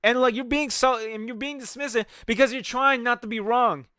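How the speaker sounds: ordinary talk, nothing marked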